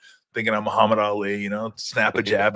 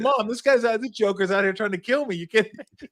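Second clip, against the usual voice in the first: higher, mock-whiny plea to mom; whiny kid calling mom; 10 semitones higher than usual. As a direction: play the scared son tattling to mom. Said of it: "I was like".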